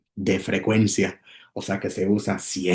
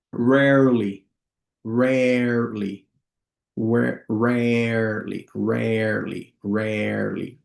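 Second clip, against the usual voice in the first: long held vowel in "rarely"; slow model pronunciation; much slower than usual. Shown as a demonstration. Teacher models "rarely" for students to practise, slowly. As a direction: draw out "rarely" syllable by syllable. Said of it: "lo voy a decir lento".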